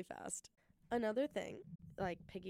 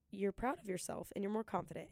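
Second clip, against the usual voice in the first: ordinary talk, nothing marked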